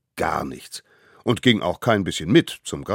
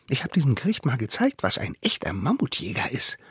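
quick, higher cocky voice; boastful child; 7 semitones higher, faster than usual. A narrator's reading. Smallest savage brags about the court, cocky.

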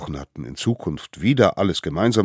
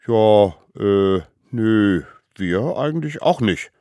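sheepish denial; 6 semitones higher, 10 dB louder, slower than usual. Acted. Little ones sheepishly deny the gift.

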